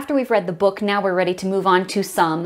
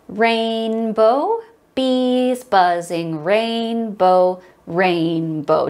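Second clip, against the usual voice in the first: slow, measured chant on the beat; rhythmic speaking; much slower than usual. Shown as a demonstration. Teacher leads class speaking text in rhythm, chanting. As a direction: stretch each word to fill the beat. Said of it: "Let's speak this on text".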